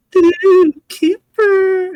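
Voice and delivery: high, loud squealed singsong; singsong call. Retold or imitated. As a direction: sing out the name like a giddy teenage girl. Teen girl sings out to Cooper.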